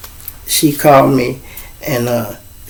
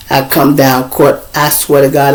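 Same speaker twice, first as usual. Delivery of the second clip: ordinary talk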